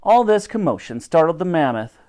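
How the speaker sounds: plain reading voice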